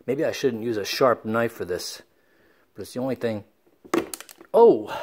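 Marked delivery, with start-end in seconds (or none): 4.5-4.9 surprised. Real, not acted. Sees his bloody thumb.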